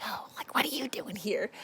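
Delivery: breathy menacing whisper, hushed; creepy whisper. Retold or imitated. Rhys closing in on Feyre, creepy whisper. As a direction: hiss it like a predator closing in.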